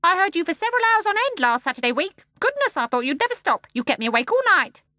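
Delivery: high, loud, shrill scolding; indignant starling. Acted. The starling scolds John for crying, shrill and indignant. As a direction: rattle off the complaint shrilly.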